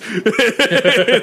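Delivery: high-pitched burst of laughter. Real, not acted. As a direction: let out a sharp high laugh. Laughs at the car story.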